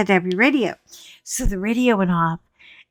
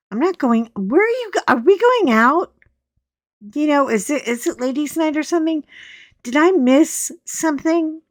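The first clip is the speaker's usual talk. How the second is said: much higher, rising puzzled questions; high, fast bewildered asking; confused rambling; 7 semitones higher than usual. Retold or imitated. Quoting her own confused questions.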